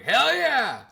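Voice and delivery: high, loud whoop; cousin's loud cheer. Retold or imitated. Cousin cheering at the vows, loud whoop. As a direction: shout it out pumped. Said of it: "my cousin was like".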